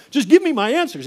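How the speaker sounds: high, loud exasperated plea; mock-frustrated protest; retold impatient demand.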